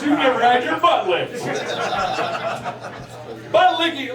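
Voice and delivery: ordinary talk, nothing marked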